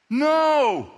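high, loud, drawn-out no; acted shout of denial; emphatic denial. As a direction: deny it at full voice. Peter's louder second denial.

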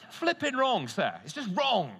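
high and loud schoolboy protest; indignant shout. Retold or imitated. Fifteen-year-old pupil protesting to the teacher, loud indignant shout. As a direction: insist it is just wrong, shouting. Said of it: "shouted out".

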